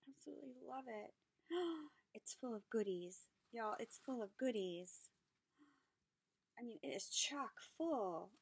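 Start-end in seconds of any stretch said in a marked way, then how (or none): none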